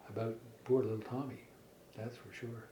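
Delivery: ordinary delivery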